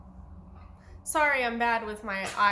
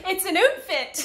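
"outfit" said "ootfit", louder and laughing; mock Canadian accent; 6 semitones higher, 8 dB louder than usual. Friend teasing vlogger's Canadian "outfit", laughing mock accent. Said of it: "You said that's so Canadian".

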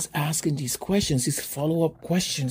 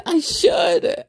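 amused; 3 dB louder than usual. Real, not acted. Laughing reply to podcast idea.

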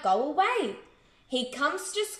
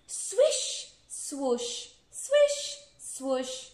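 tail swishing; much slower than usual. Acted. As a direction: imitate a tail flicking back and forth in singsong. Voicing the donkey's swishing tail.

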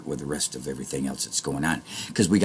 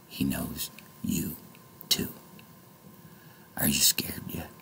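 ominous whisper; 10 dB quieter than usual. Real, not acted. Ominous warning to viewers.

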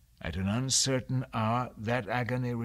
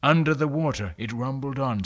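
plain reading voice

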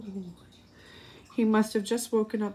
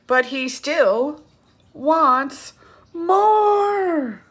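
rises higher and louder to a cry on more; building refrain; 7 semitones higher, 7 dB louder, much slower than usual. Shown as a demonstration. Reading to children, building to a loud cry. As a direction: swell up to the last word.

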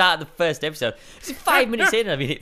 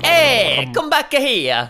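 high, loud cartoon Italian shout; Mario; 8 semitones higher, 5 dB louder, much slower than usual. Friend playing Mario calls after someone, loud cartoon Italian. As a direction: call after them in a hammed-up Italian accent. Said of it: "you've gotta do the Mario voice".

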